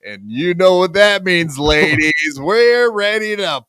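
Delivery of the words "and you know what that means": usual speaking voice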